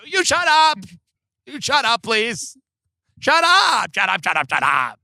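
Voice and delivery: high-pitched outburst that drops away through the repeats; mock bratty retort. Friend shouting down his mom as a bit, mock bratty. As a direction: imitate a kid talking over a parent.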